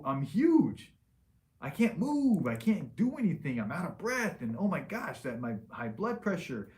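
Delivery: high, loud mock panic of a patient who has let himself go; panicked realization. Doctor voicing a future patient in rising, high, loud mock panic. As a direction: voice it like someone waking up horrified at their own body.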